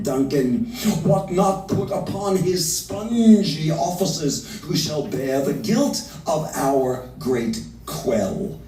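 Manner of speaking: slow, heightened verse reading; Lady Macbeth's plotting speech, read slowly; dramatic reading. Acted. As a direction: give the verse full weight.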